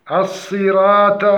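"الصراط" with light r and light t; thinned ra and ta. Shown as a demonstration. Teacher demonstrates the error, light ra and ta in الصراط. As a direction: slowly flatten "الصراط" to a thin "siraata". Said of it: "وترقيق الراء والطاء".

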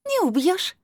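very high, taunting crystal voice; silvery amused voice. A narrator's reading. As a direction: tease from high up, invulnerable. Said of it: "засмеялся серебристый голос". (высоким серебристым голосом, насмешливо)

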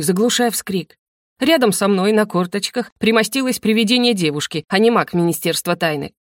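plain reading voice

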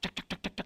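whispered rapid "cetak, cetak, cetak" like abacus beads; whispered clicking. Retold or imitated. Friend working the abacus, quick whispered "cetak" clicks. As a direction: tick off the beads under your breath.

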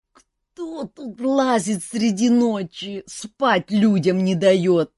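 slow, high groaning complaint. A narrator's reading. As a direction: moan it, dragged from sleep. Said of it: "простонал Жак".

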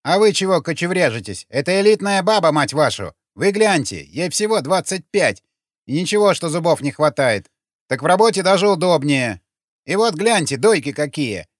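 insistent, excitable sales patter; pushy pimp. A narrator's reading. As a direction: loudly talk up the goods to reluctant customers. Pimp hawking his woman to passers-by.

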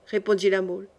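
ordinary delivery, nothing marked

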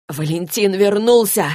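loud, slow outcry; crying out. Acted. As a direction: cry out the news loudly. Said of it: "выкрикнул пленник". (громкий выкрик)

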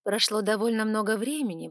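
ordinary delivery, nothing marked

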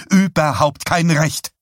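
high, loud protest; indignant outburst. A narrator's reading. Wife tells husband he has no right, indignant. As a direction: burst out in indignation.

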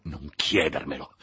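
ordinary delivery, nothing marked

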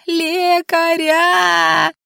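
very slow, high drawn-out shout; hysterical wail. A narrator's reading. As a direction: stretch the cry into a long high wail. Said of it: "истерично завопила Анфиса".

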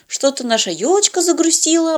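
put-on high, mildly worried girl voice; concerned. A narrator's reading. Girl worries about the little fir tree, concerned. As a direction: remark with light, high-pitched concern. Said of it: "озабоченно заметила Полина".

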